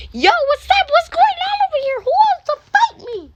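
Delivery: high, loud challenge to a fight; angry shouting son. Acted. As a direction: bark it out like a kid picking a fight. Son confronts dad hitting mom.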